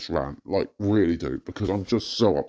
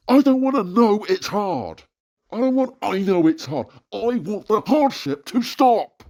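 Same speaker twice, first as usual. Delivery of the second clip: much higher and louder, pleading anger; distressed outburst; 11 semitones higher, 4 dB louder, slower than usual. Real, not acted. Ranter crying out that his hardship must stop, distressed and angry. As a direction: demand it stop right now. Ranting about unending hardship.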